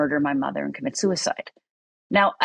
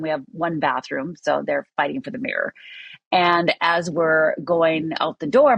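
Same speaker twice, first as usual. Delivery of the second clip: normal talking voice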